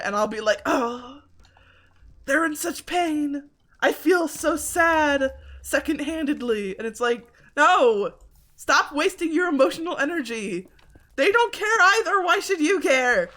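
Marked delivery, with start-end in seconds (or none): 0.7-6.8 mock heartbroken. Mocking own over-sympathy. 7.6-13.3 high, loud scolding; louder and higher, snapping at her own feelings; exasperated self-scolding. Pretend. Scolding herself.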